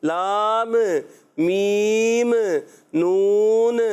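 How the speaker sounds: put-on demonstrating the wrong way children read لام میم نون; wrong jolted letters. Shown as a demonstration. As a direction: clip each of لام میم نون with a bounce at the end. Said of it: "ایسا بھی بعض بچے کرتے ہیں".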